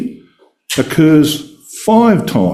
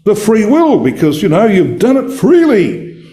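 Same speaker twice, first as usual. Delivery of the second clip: quoting a confident answer, pitched higher; people's typical response; 8 semitones higher than usual. Retold or imitated. Giving people's usual answer about what matters to God, imitated higher. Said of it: "I normally get the response".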